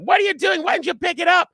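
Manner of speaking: high, loud scolding yell in imitation; yelling woman. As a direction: imitate an outraged shriek, pitched very high and loud. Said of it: "she's yelling at me".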